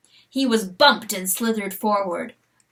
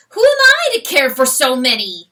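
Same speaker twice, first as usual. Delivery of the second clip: louder, raised complaint to the sky; exasperated outcry; 4 semitones higher, 9 dB louder than usual. Acted. Rooster complaining about caring for many, loud. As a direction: throw the question up in vexation.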